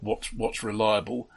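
ordinary talk

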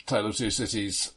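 usual speaking voice

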